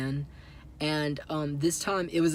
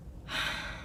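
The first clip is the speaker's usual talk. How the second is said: re-enacted breathy sigh of indecision; heavy sigh; 9 dB quieter than usual. Telling how they had to pick one daylily to name, stumped sigh. Acting out not knowing what to say.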